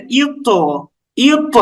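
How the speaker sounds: "ইউত" with a bounced heavy t; pushed qalqalah. Shown as a demonstration. Teacher pushes the letter to show learners, bouncing qalqalah. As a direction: give the final t of "ইউত" a push and release. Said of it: "ধাক্কা দিয়ে উচ্চারণ করছি".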